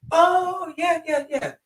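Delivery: high, loud sudden understanding; a Japanese woman suddenly getting it, bright and high; mock delighted realisation. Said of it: "And they're like".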